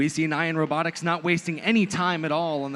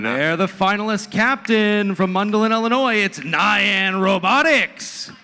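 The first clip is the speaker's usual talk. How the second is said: fighting game announcer; 4 semitones higher, 6 dB louder than usual. Acted. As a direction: stretch the team name like a fight intro. Hype team introductions.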